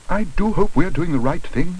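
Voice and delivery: lightly voiced worried mother; worried woman. A narrator's reading. Mrs Brown worrying.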